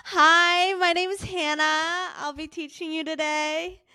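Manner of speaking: bright, high instructor welcome; fitness instructor voice. Pretend. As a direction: greet the class like a peppy instructor. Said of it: "is that your fitness instructor voice?".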